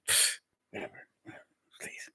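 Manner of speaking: whispered dismissive mutter; under-the-breath scoff. Retold or imitated. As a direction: brush it off under your breath. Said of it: "said to parents underneath our breath".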